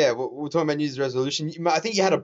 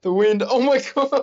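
alarmed; 10 semitones higher, 6 dB louder, slower than usual. Real, not acted. Tower wobbling in the wind.

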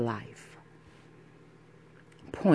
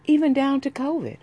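incredulous; 12 semitones higher, 4 dB louder than usual. Real, not acted. Rant peak.

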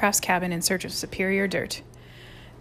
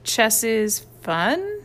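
slow, limp, unconvinced; lame; 2 semitones higher, much slower than usual. A narrator's reading. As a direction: say it without believing it. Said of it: "said Mike lamely".

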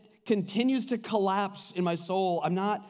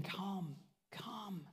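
soft calling; 16 dB quieter than usual. Acted. Jesus gently calling, invitation.

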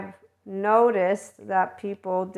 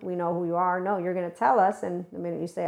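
usual speaking voice

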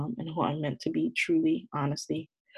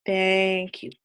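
excited; 12 semitones higher, 4 dB louder than usual. Real, not acted. Agreeing emphatically with friend.

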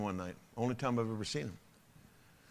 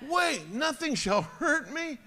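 much higher, slow whining question; whiny complainer; 12 semitones higher, slower than usual. Acted. Pastor mocks a whiner in the pews, high slow whine. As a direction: whine like a wounded baby. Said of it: "you little whiner".

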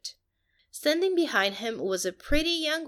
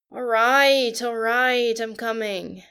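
loud, raised voice fading to plain; man calling back through a hotel door, loud then settling; called out from behind a door; 7 dB louder, slower than usual. A narrator's reading. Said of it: "Shannon called".

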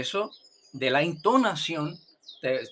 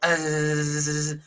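throat sound into z. Shown as a demonstration. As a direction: hold "eh" and let it turn to zzz. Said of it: "cuanto más llega cerca". (sonido de garganta que acaba en zumbido)

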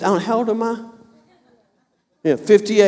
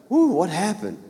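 shaken and hushed; 7 dB quieter than usual. Retold or imitated. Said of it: "kinda like shaking".

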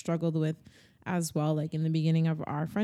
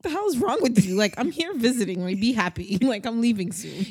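exasperated scolding; 5 semitones higher, 4 dB louder than usual. Retold or imitated. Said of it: "I was just like".

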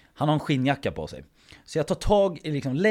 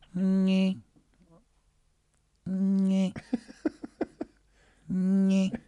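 higher, held monotone noise; imitated drawn-out drone; 6 semitones higher, much slower than usual. Drunk friend at the door keypad, high droning imitation. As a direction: hold one whining note each time.

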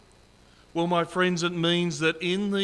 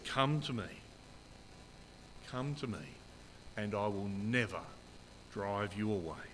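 softer, lower, hushed "come to me"; tender invitation; 7 semitones lower, 11 dB quieter than usual. Acted. Pastor voicing Jesus inviting the weary, soft and tender. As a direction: draw the listener in gently, almost a murmur.

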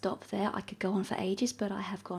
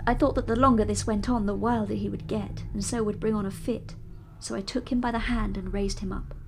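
steady, measured reading of a classic novel; story narration; 5 dB louder than usual. A narrator's reading. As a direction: read it like an audiobook narrator. Narration resumes.

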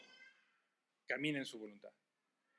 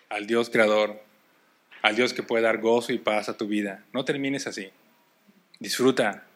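shouted, much louder preaching; fiery preaching; 6 semitones lower, 19 dB louder than usual. Acted. Pastor exhorting the church not to end like Jonah, shouting. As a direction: drive it home to the whole room.

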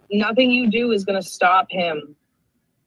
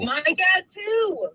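mocking squeaky echo; 11 semitones higher, much slower than usual.

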